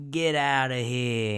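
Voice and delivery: drawn-out mock Italian-American brush-off; Italian impression. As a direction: send them away in a slow Italian-American accent. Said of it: "That's why I went into my Italian impression".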